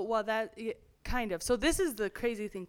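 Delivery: usual speaking voice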